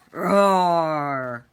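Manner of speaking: loud drawn-out roar; dragon roar. Acted. Narrator roaring as the dragon in battle.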